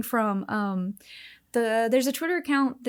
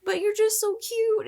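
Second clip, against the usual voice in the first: excited; 10 semitones higher than usual. Real, not acted. Gushing over cute grape.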